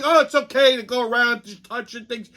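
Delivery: high, airy put-on voice; mocking clueless people. Teller mocks careless people who touch his stuff, high and airy. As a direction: sneer at their careless thinking. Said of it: "they just think".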